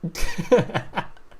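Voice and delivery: high laugh running out of air; breathless laughter. Real, not acted. Player still tickled by the turtle card, laughing breathlessly. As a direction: laugh until little voice is left.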